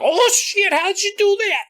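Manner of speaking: very high, amazed mock cry; hammed-up awe at a magic trick; easily impressed king. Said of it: "He's gonna be like".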